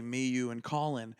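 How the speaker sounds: ordinary talk, nothing marked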